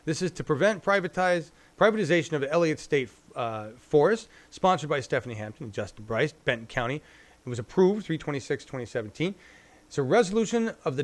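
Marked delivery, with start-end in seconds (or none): none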